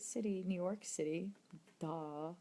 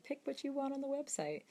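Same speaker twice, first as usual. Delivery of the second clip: lightly voiced senders, pitched up; a hint of put-on higher voice, quoting an offer; imitating website senders; 7 semitones higher than usual. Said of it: "they're like".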